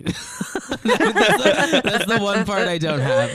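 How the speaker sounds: talking through loud laughter, pitch up; real laughter. Real, not acted. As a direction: laugh your way through the admission. Laughs through own unemployment admission.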